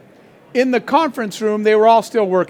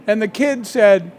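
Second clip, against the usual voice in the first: ordinary talk, nothing marked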